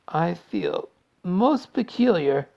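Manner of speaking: slow, high, woozy; faint gasp. A narrator's reading. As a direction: draw it out as if unwell. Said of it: "gasped Violet".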